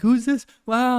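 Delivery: high-pitched mock delighted wonder; happy villagers imitation. Villagers happy to see a stranger, high amazed imitation. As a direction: voice friendly onlookers, pitched well up. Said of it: "happy to see me".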